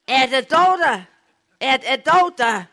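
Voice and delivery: yelling down the line to be heard far off; shouting into the phone. Retold or imitated. Farmer bellowing into the telephone to reach the doctor, loud. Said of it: "jeg råbte så nogen nær så højt".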